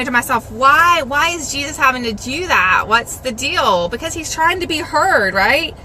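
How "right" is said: normal talking voice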